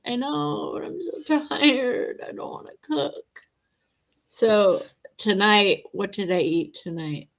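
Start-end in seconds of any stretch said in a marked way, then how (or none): 0.1-3.4 whiny and tired. Retold or imitated. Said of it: "And I'm like".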